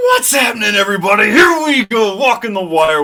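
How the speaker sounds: loud, high shouted welcome; hype greeting. Real, not acted. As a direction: fire up the chat.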